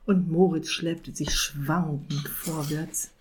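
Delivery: plain reading voice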